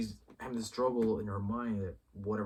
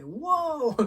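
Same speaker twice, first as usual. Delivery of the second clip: high, loud whoa of shock; stunned whoa; 8 dB louder than usual. Retold or imitated. Viewer reacting to the missile, high startled whoa. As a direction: let the surprise burst out. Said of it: "I'm like".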